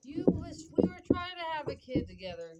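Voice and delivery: mock-indignant comeback to an ex; indignant protest. Retelling her protest to ex.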